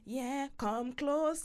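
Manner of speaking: put-on slow, drawn-out Jamaican accent; mock Jamaican accent. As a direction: imitate a singer's crooning Jamaican accent. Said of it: "the Jamaican accent downloaded".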